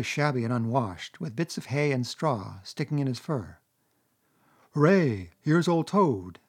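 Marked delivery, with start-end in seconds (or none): 4.7-6.4 put-on bright, eager greeting to an old friend; joyful cry. A narrator's reading. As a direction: call out in delight. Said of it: "cried the Mole".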